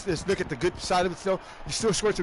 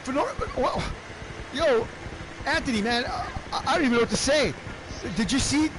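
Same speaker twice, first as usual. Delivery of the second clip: excited play-by-play; 6 semitones higher than usual. Acted. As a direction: shout out the crash as it happens. Calls a wreck live.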